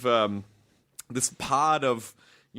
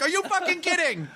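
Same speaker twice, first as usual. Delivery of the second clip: incredulous. Retold or imitated. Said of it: "My parents are like".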